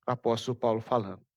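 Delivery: ordinary talk, nothing marked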